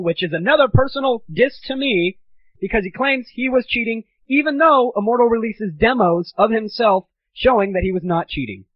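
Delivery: normal talking voice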